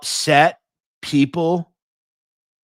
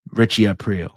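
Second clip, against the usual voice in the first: ordinary talk, nothing marked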